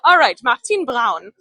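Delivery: name it in a playful German accent; hammed-up German accent. Shown as a demonstration. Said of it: "I'm just gonna say everything in the German accent".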